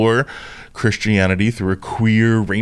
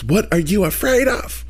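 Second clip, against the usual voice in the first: lightly voiced Leslie Jordan impression; 8 semitones higher than usual. Retelling his therapist, mimicking him like Leslie Jordan. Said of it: "He sounded like Leslie Jordan".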